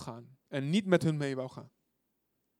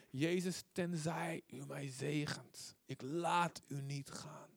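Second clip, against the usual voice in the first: much softer, slow and intimate; hushed plea; 3 semitones lower, 11 dB quieter, slower than usual. Acted. Preacher softly voicing the blind man's stubborn plea, slow and hushed. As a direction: drop to a soft, slow plea.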